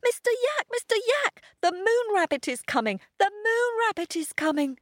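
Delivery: high, breathless little dog voice; panting Pip. A narrator's reading. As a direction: pant it out, high and urgent. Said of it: "panted Pip".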